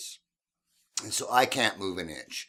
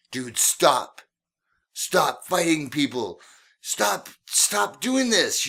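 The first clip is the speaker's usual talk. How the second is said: rising, slow exasperated pleading; begging him to stop; 6 semitones higher, 4 dB louder, slower than usual. Retold or imitated. Girlfriend begging her drunk boyfriend to stop fighting.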